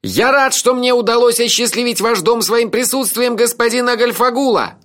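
high-pitched, full-lunged holler; shouted courtesy, pitched very high; frantic yell. A narrator's reading. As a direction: bellow a formal greeting at the top of your voice. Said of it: "истошно завопил".

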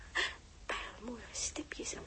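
slow breathy whisper; unhurried whispered self-talk. Acted. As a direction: whisper it to the mirror, taking your time. Dressing up alone, whispered.

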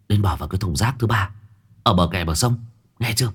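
disguise your voice as a kidnapper; a hint of a put-on husky threat; faked hoarse voice. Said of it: "cố tình giả giọng khàn khàn".

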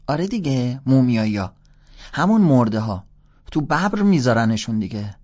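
put-on loud, high boy insisting; a kid explaining with loud certainty; insistent kid. A narrator's reading. Boy explains mummies to the older stranger, loud and high.

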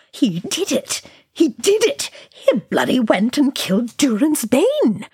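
high, wildly excited cries; gibbering wildly. A narrator's reading. Dwarf jumping as the Balrog falls, gibbering wildly. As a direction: gibber with disbelieving jubilation. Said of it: "Oin gibbered wildly".

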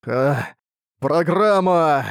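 slow, dying final words; drawn-out dying gasp of a word; last breath. Acted. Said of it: "издал предсмертный вопль и прошептал".

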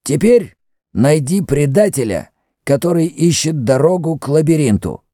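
high, commanding priest; Mefres the priest. Acted. High-pitched, deliberate order.

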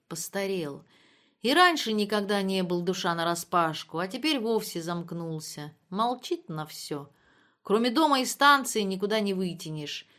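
usual speaking voice

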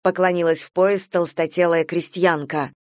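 ordinary delivery, nothing marked

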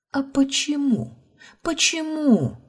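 high-pitched, drawn-out demand; upset, insistent high cry; tearful anger. A narrator's reading. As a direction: plead angrily, voice leaping high. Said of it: "Злобно утёрла выступившие из глаз слёзы".

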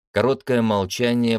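usual speaking voice

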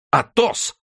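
very high startled cry; astonished exclamation. A narrator's reading. D'Artagnan suddenly recognises his friend, astonished exclamation. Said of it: "воскликнул Д'Артаньян".

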